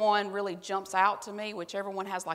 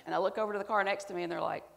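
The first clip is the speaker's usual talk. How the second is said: usual speaking voice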